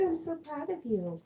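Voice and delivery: overjoyed praise for the dog; excited praise. Real, not acted. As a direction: squeal it with delight. Dog found its toy.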